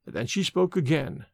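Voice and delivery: ordinary delivery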